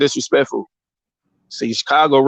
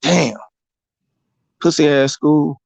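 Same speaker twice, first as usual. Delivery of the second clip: put-on sulky kid; 5 semitones higher, slower than usual. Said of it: "He like".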